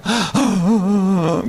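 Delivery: a sharp crying gasp in mid prayer; sobbing gasp. Acted. Preacher mimicking a student sobbing in prayer, a gasp. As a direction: mimic a sob breaking through.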